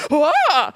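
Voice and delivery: short mock yelp; crazy noise. Old crew member startling at the doll, imitated crazy noise. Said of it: "He makes a crazy noise".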